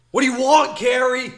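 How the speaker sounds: hammed-up Michael rage yell at the door; furious shout. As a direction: scream it at Carrie like a raging gamer. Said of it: "He's like".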